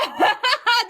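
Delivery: high burst of laughter; giggling laugh. Real, not acted. Saying the comments are fair, high amused laugh. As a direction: break up laughing mid-thought. Laughing about own remark.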